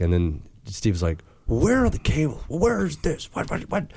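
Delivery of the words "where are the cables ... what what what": high, frantic string of questions; frantic demanding. Retold or imitated. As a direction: fire off the questions in a high panic. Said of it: "It wasn't quite so aggressive".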